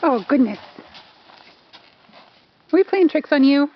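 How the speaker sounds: ordinary talk, nothing marked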